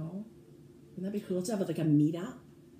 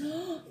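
delighted gasp; 9 semitones higher, 4 dB quieter than usual. Real, not acted. Sees the new bag.

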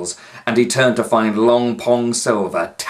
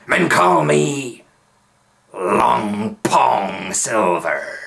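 slow, louder pirate boast; slow pirate drawl; 4 dB louder, much slower than usual. A narrator's reading. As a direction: stretch the name out proudly. Pirate names himself.

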